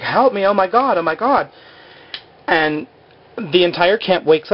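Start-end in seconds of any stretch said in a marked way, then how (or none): 0.0-1.5 high-pitched mild mock panic; mock screaming. Woman held down at night, half-hearted high scream for help. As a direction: imitate a scream for help without full volume. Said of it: "screaming, like".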